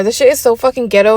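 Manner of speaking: normal talking voice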